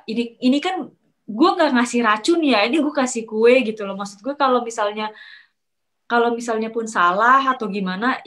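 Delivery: usual speaking voice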